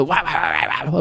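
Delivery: quick vocal blast of mock metal; imitated metal song. Imitating the band's tiny song, nonsense metal wailing. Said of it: "their songs are, like, twenty seconds long".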